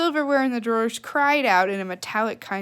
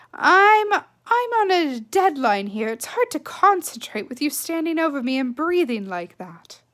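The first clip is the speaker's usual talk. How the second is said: exasperated mother; 5 semitones higher, 4 dB louder than usual. Acted. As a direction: push her away, on a deadline. Mother annoyed by deadline.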